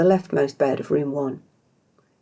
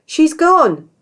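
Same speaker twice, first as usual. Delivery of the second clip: much higher, loud panicked cry; alarmed shout; 13 semitones higher, 8 dB louder than usual. Acted. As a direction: burst in with bad news. Squire bursts in alarmed.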